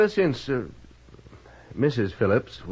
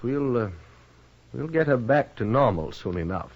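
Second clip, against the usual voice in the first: usual speaking voice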